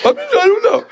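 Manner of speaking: high, tearful whine; imitated sobbing through the words; mock crying. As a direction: whimper it pitifully, hammed-up. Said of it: "I'm mumbling and crying the whole time".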